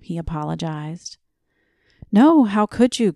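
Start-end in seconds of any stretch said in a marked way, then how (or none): 2.1-3.1 sympathetic outcry, higher and louder; cried out. A narrator's reading. Young man answering the old man's rationing, crying out. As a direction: burst out in disbelief. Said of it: "cried the young man".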